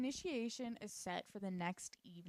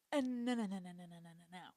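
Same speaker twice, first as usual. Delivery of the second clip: fading no's; 2 semitones lower, faster than usual. Retold or imitated. Sweet denies, fading.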